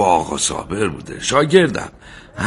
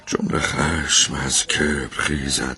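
low, slow verse reading; poem recitation; 7 semitones lower, 3 dB quieter than usual. Acted. Tailor reciting verse on anger and pride, low poem recitation. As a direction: recite slowly and softly in measured verse.

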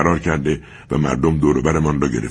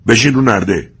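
loud, higher barked command; shouted order; 6 semitones higher, 6 dB louder than usual. Acted. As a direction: shout him onto the railing. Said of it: "فریاد کشید".

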